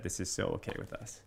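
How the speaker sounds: soft whisper, as a gentle father; a father's reassuring whisper. Retold or imitated. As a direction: whisper it close to his ear. Sensitive father quietly reassuring son.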